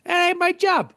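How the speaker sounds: high, careless dismissal; mock shrugging brush-off. Cart pusher tells the driver it isn't his job, dismissive. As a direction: shrug it off like it's nothing. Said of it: "I said".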